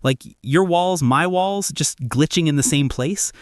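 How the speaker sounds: usual speaking voice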